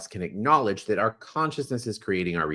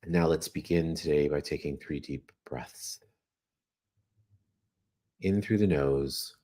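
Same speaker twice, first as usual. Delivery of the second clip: guided meditation; 6 semitones lower than usual. Acted. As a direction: guide them gently through each breath. Leads breathing meditation.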